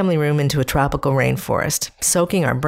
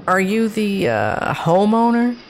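higher, halting, unsure question; hesitant painter; 5 semitones higher than usual. Retold or imitated. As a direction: ask it carefully, stretching homeowner. Painter asks a puzzled question.

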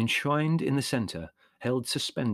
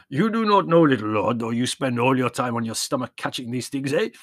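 put-on warm old peasant shepherd, proud of his trick; old Yanni voice; 3 semitones higher, 7 dB louder than usual. A narrator's reading. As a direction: voice the old shepherd showing the boy. Yanni teasing the boy.

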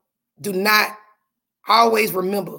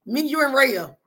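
laughing; 6 semitones higher, 4 dB louder, faster than usual. Real, not acted. Laughing, excited at Miss Laura.

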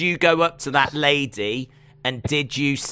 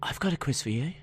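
soft, lower reply; quiet surprise; 4 semitones lower, 11 dB quieter, faster than usual. Real, not acted. Answering co-host who also has a quiz, quietly. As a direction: say it quietly, under your breath.

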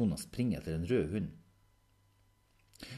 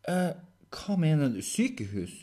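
mildly startled, louder question; taken aback; 9 semitones higher, 8 dB louder than usual. Acted. Henrik hearing talk of hospital, mildly taken aback. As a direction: question it in slight alarm.

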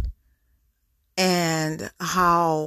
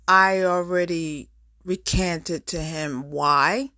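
usual speaking voice

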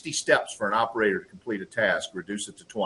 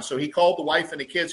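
normal talking voice

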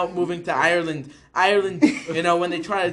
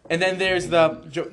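usual speaking voice

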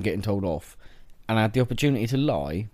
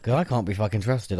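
ordinary talk, nothing marked

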